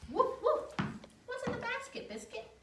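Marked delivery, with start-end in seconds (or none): 0.1-0.7 high, loud cheerful woof; playful dog bark. Acted. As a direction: bark like a happy puppy for the child. Dog sound in picture book.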